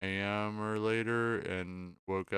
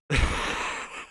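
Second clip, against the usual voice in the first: burst of laughter; 4 dB louder than usual. Real, not acted.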